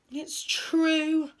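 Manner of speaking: high, sorry little sigh. A narrator's reading. Monster admits to Owl it isn't scary, sighing. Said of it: "the monster sighed".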